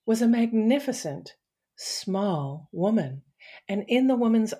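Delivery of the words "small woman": ordinary delivery, nothing marked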